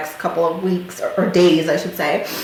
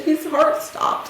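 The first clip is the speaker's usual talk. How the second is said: higher, slow, hushed telling; 9 semitones higher, much slower than usual. Retold or imitated. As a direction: say it stunned and slowly. Breaking the news to mom.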